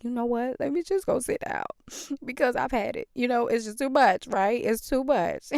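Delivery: mock exasperated I've had it; fed up. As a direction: throw up your hands, you've had it. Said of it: "So I was like".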